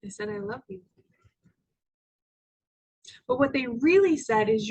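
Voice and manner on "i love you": soft, higher-pitched loving voice; tender. Retold or imitated. Parents answering their upset daughter, soft and tender. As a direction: say it gently and lovingly.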